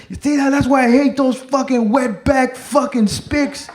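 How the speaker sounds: high-pitched angry mimicry of a ranting friend; venting out loud. Comic acting his friend's rant about kids, venting. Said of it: "now he's just venting out loud".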